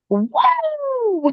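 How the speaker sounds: high whooping cheer; mock celebratory woo; imitated excited woo. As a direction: let out a big high woo. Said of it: "she gets excited".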